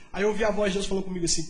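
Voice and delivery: ordinary talk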